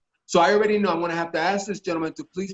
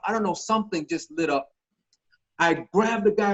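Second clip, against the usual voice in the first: ordinary talk, nothing marked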